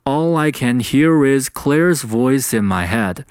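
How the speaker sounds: slow, with "All I" and "voice in" run together; one unbroken breath linking "hear is" and "voice in"; careful demo linking "All I" and "voice in"; linked-up sentence. Shown as a demonstration. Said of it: "气流是不中断的".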